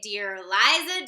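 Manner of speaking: ordinary delivery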